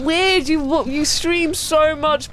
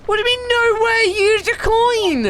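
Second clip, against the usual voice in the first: outraged disbelief; 10 semitones higher than usual. Real, not acted. Reacting to character refusing.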